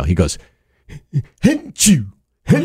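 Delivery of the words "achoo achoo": dad's sneeze. Retold or imitated. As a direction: imitate a big sneeze. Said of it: "I could do my dad's sneeze right now".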